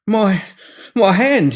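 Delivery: staring at his own stump in disbelief; shocked murmur. Acted. Pinky seeing his severed hand, shocked. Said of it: "he murmured".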